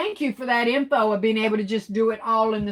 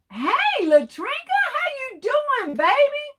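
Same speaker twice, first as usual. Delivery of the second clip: much higher, bright sing-song hello; excited high greeting. Real, not acted.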